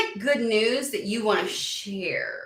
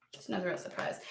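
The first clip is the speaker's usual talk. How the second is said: softer, dropped-down echo of the line; quiet repeat; 2 semitones lower, 14 dB quieter than usual. Real, not acted. Quietly repeating to viewers, knowing and much softer. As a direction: say it again under her breath, much softer. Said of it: "But it's no real surprise".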